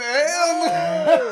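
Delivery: loud, high shout of disbelief; real shocked outcry; disbelieving shout. Real, not acted. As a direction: yell it laughing in disbelief.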